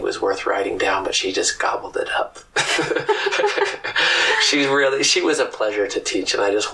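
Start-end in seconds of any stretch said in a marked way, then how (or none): 2.5-4.4 a sudden high airy laugh; burst of high laughter. Real, not acted. Teacher laughing at own joke.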